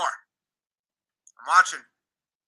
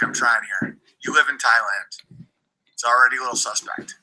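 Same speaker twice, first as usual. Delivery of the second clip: usual speaking voice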